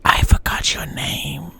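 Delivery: slow awkward whisper; whispered aside. Retold or imitated. Trapped listener to oversharing friend, slow whisper. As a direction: whisper it like a guilty secret.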